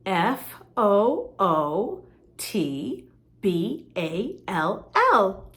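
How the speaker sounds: F O O T B A L L named letter by letter, very slow; slow, careful letter-by-letter spelling for kids. Shown as a demonstration. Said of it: "bigger words like football".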